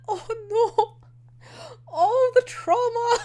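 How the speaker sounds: high, whiny wail of pity; mock lament. Fan reacting to a character's flashbacks, high mock lament.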